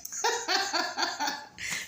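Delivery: breathy run of chuckles; soft, drawn-out scoffing laugh; derisive laugh. Real, not acted. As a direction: laugh it off in disbelief. Scoffing at false prophecy story.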